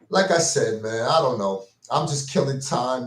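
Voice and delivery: ordinary talk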